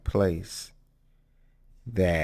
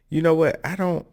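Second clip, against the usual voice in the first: ordinary talk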